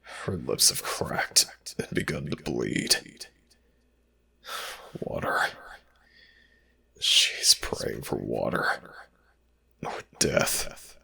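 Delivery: hushed, breathy narration; whisper. Acted. Narrator whispering over the suffering slave girl. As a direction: whisper it like a secret witness.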